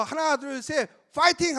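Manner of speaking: high count-in building to a cheer; rallying one-two-three chant; countdown cheer. Acted. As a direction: count the team in, then call out. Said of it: "저 외국 가서".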